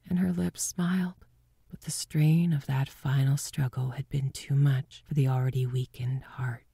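ordinary delivery

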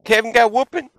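put-on high, stammering kid voice; little brother telling Dad about the whooping; tattling little brother. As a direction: report it eagerly to Dad. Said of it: "He said".

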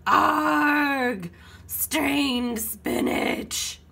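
drawn-out, disgusted pirate wail. A narrator's reading. Pirates sneezed on with baby food, long wail. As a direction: wail it slowly like grossed-out pirates. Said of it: "wailed the pirates".

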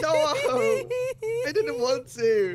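high, slow laughing plea. Real, not acted. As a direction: laugh through the denial. Laughing denial.

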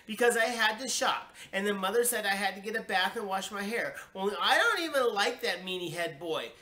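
high, pushed complaining; child's indignant rant. A narrator's reading. Child complains to parents about party, indignantly. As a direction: pile up grievances like an indignant kid.

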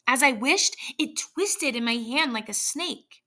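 high, shaken horror; horrified. Acted. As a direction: still reeling in fright. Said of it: "with a look of horror".

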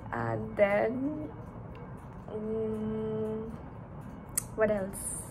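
ordinary talk, nothing marked

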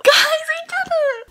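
very high, loud, drawn-out cry of joy; triumphant squeal. Real, not acted. Vlogger tells viewers she hit a hundred thousand, squealing triumphantly. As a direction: scream it out in tearful triumph. Reaching the milestone, just cried.

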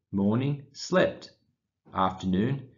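ordinary delivery, nothing marked

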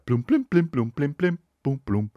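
high plunking "bloom" notes, falling away; vocal imitation of quirky scene music; mock TV music cue. Mocking Grey's Anatomy's music, plinky imitated cue.

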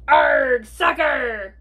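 loud pirate "arg" that eases off; pirate yell. A narrator's reading. Captain yells while kicking the ball, pirate yell. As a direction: yell it as you kick. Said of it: "kicked the ball and yelled".

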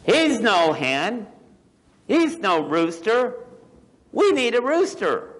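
high, loud hen voices, rising to a peak; objecting hens in a children's story; complaining hens. Acted. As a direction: squawk it high like indignant hens. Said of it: "is what the chickens were saying".

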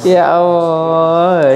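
drawn-out slow groan of pain. Real, not acted. After the stretch, in real pain: slow groaning cry. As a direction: drag it out as the pain hits. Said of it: "ini sakitnya luar biasa".

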